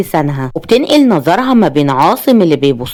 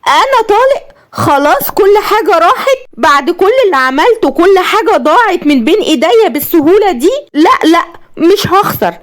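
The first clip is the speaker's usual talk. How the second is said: high, manic, half-laughing rant; ruined wife ranting hysterically; 11 semitones higher than usual. A narrator's reading. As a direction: lose your mind over the divorce. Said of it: "وقالت بهستيريا".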